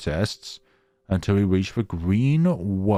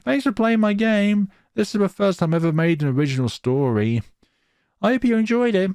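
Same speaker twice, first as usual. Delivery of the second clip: much higher, bright greeting read out; cheerful developer voice; 9 semitones higher than usual. Acted. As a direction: read the dev note chirpy and high. Reads developer's message in put-on voice.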